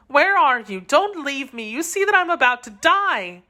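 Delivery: put-on high, pleading young woman; Constance pleading. A narrator's reading. Dying Constance begs D'Artagnan to stay with her. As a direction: beg him not to leave her. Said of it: "dying Constance calls D'Artagnan".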